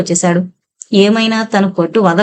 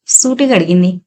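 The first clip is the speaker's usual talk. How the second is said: ordinary delivery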